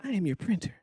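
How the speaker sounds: put-on printer speaking in a girl's voice; lightly voiced woman printer; man doing a woman's voice. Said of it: "it's gotta be a girl's voice".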